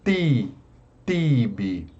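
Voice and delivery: "ti" and "tibi" with a clean T; hard stressed T. Shown as a demonstration. Teacher modelling the stressed syllable, "tibi" with firm T. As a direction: stress "ti" in "tibi", T not softened. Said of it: "a sílaba forte é o ti".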